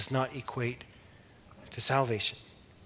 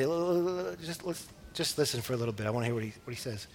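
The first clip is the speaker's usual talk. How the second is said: hushed eager aside; 7 dB quieter, faster than usual. Retold or imitated. Officers hush to listen.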